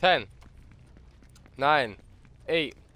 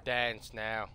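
low, softer pretend captor voice; mock menacing threat; 6 semitones lower, 4 dB quieter than usual. Captor warning a player to dance, low menace. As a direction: drop low and threaten, play-acting.

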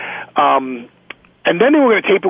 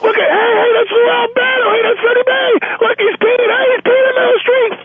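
excited onlookers. Retold or imitated. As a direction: call out to everyone, amazed. Crowd shouting at peeing wrestler.

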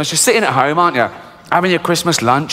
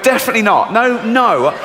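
higher, flat-out refusal; refusing goat; 7 semitones higher than usual. Retold or imitated. The goat answering the charity worker, higher refusal. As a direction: goat says no firmly. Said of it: "It went".

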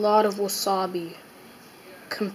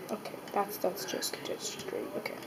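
whispering; 14 dB quieter than usual. Real, not acted. Dreading the wasabi bite.

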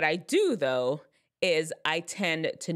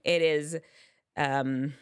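normal talking voice

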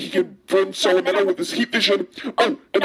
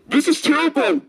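high, fast alarmed outburst; shocked gasp; 9 semitones higher than usual. Acted. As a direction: gasp and panic at the news. Hearing of the kidnapping.

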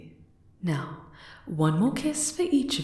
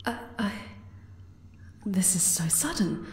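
stunned whisper; 6 dB quieter than usual. Acted. Overwhelmed by a sudden proposal.

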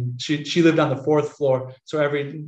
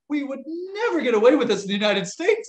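shocked disbelief; 11 semitones higher than usual. Retold or imitated. Quotes own shocked thought.

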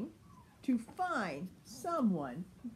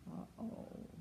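whispered uh-oh; 2 semitones lower, 13 dB quieter than usual. A narrator's reading. Whispered reaction.

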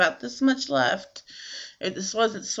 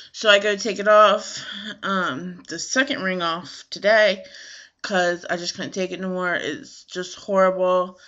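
usual speaking voice

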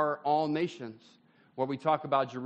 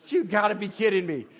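exasperated; 4 semitones higher, 5 dB louder than usual. Retold or imitated. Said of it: "What do you think Jesus did".

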